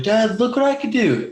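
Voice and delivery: put-on high child's voice calling out to dad; a six-year-old showing off for his father; proud little kid. Kid calling to his dad from the street, high and proud.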